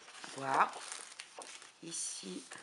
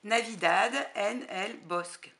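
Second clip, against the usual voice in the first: Spanish title again, louder and rolled out in a Spanish accent; proud Spanish accent; 3 semitones higher, 9 dB louder than usual. Shown as a demonstration. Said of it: "encore plus joli que mon accent anglais".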